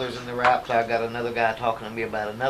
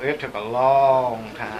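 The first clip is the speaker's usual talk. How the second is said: slow, drawn-out Oklahoma drawl; Oklahoma accent; 4 dB louder, slower than usual. Shown as a demonstration. Actor showing how his home accent drags, Oklahoma drawl. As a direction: stretch it out in a thick Oklahoma twang. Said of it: "'Cause the way we talk is".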